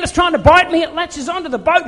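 ordinary talk, nothing marked

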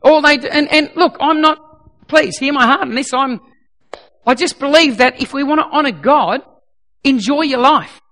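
ordinary delivery

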